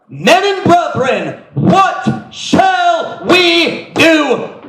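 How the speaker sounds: high, drawn-out shouted plea; desperate crowd cry. Acted. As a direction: cry out slowly, word by word, begging an answer. Acts out pricked crowd at Pentecost.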